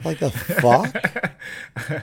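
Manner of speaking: very slow, stretched-out "the fuck?"; drawn-out retort. Acted. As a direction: drag "the fuck" out, slow and soft.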